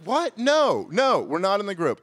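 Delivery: high, indignant comic refusal; furious denial. Retold or imitated. Guest tells hotel manager he's not in the group, furious. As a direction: start very high in outrage, then drop a little. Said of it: "I was just getting furious".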